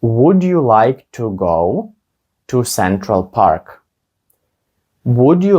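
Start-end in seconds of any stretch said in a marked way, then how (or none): 0.0-3.8 slow, careful, with pauses between chunks; slow model question. Shown as a demonstration. Teacher modelling the question for students, slow and careful. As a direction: lay it out slowly for learners. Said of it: "teacher models slow, then normal".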